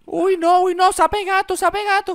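high, loud shriek shooing a cat; shooing away. Acted. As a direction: shriek to scare it off. Espanta al gato con asco. (grito agudo para espantar)

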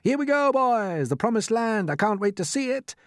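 high, loud rallying cries; excited shouts. A narrator's reading. Company setting off up the ladder, high excited shouts. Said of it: "mounting excitement and shouts of".

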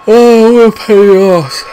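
loud, high exasperated yell; frustrated outburst. Real, not acted. Gamer fuming at his team, loud exasperated yell. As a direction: let the frustration burst out loud.

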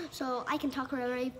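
normal talking voice

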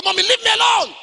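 put-on high, loud yelled child's protest; mock child tantrum. Preacher voicing the boy shouting at his mother, high mock yell.

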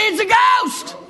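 a high, startled yelp; mock the disciples' panicked cry; frightened cry. Disciples retold by the preacher, a high frightened cry. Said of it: "and they say".

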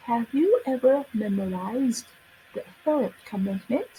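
teacherly yes-no question, rising on "commandment"; rising question tune. Shown as a demonstration. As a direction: push the pitch up on the last syllable of "commandment". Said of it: "commandment นะคะ ขึ้นเสียงสูงนะคะ".

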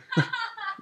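short high laugh after the aside; a quick high laugh at himself; high burst of laughter. Real, not acted. Laughs at own joke.

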